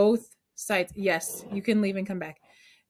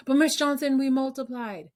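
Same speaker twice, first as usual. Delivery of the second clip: higher-pitched imitation of an objecting pupil; protesting student; 4 semitones higher than usual.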